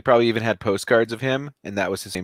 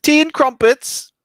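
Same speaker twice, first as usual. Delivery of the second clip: put-on British accent; 12 semitones higher, 6 dB louder than usual. Host teases about doing British accents, high mock British accent.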